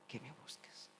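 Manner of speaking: a soft, breathy ending; whispered tenderness. Acted. God to his son, whispered and tender. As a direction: let it fade to a whisper.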